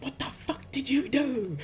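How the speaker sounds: high-pitched outraged confrontation; acted furious demand. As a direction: imitate someone demanding answers at knifepoint. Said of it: "Like, exactly like that".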